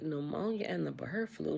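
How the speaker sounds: stunned, whispered disbelief; shocked whisper. Retold or imitated. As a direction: breathe it out in shock. Her shocked reply retold.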